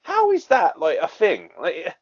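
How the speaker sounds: high, exasperated mock rant; incredulous outrage. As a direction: throw up your hands in disbelief. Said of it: "I'm like".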